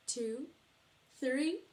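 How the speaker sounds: ordinary delivery, nothing marked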